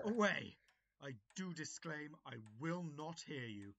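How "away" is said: loud, dismissive yell; a barked banishment; angry shout. Acted. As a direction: wave him off with a shout. Master banishes servant.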